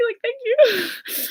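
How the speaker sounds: gushing, overwhelmed fan; starstruck squeal. Retold or imitated. Fan thanking her hero Chara, a high starstruck squeak.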